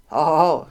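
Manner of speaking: loud, acted jeering "ho, ho, ho"; mocking ho-ho laugh. A narrator's reading. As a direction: let out a loud bully's mock laugh. Bully jeers at the victim. (lautes höhnisches Hohoho)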